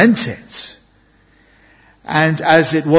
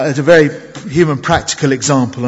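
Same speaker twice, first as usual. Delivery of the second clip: normal talking voice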